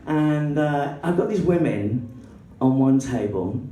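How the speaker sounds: ordinary talk, nothing marked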